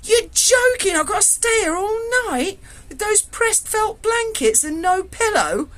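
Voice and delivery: high, loud mock sobbing complaint; mock wail. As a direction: whine like a hard-done-by prisoner. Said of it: "I went".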